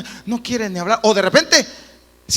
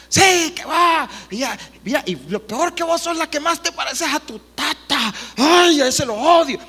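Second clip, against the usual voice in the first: frustrated parent ranting; 7 semitones higher, 5 dB louder than usual. Retold or imitated. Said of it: "comienzan tratos como".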